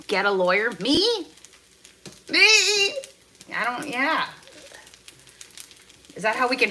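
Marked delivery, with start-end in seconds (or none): 0.8-2.9 surprised. Real, not acted. Surprised at suggestion.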